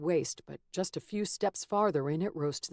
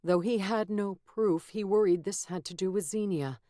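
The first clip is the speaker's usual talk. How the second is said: ordinary delivery, nothing marked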